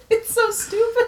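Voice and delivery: high squeal through laughter; helpless laughing. Real, not acted. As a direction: squeak it out while cracking up.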